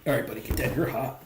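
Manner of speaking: usual speaking voice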